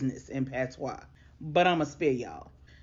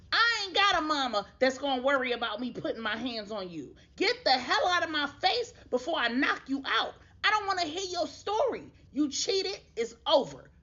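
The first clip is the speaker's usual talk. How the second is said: higher, heated threatening voice; angry breakup; 8 semitones higher than usual. Retold or imitated. Betrayed boyfriend telling his cheating girlfriend to leave, heated. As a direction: cut her off, furious. Morris dumps cheating Destiny.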